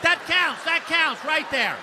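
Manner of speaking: high, loud triumphant claim; mock triumph, high and loud; eager insistence. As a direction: insist it counts.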